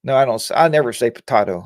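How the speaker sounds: ordinary delivery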